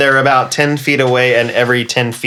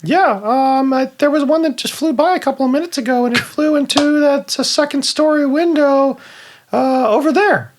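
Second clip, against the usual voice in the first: high-pitched plant giving directions; talking plant voice; 11 semitones higher than usual. Acted. Plant tells the druid what flew by, high voice. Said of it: "let me do the voice for this".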